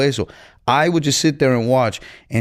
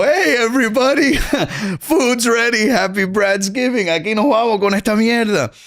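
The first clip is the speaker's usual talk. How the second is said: calling everyone to dinner; 11 semitones higher than usual. Retold or imitated. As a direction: announce the feast with a laugh. Announcing dinner at 1:30 am.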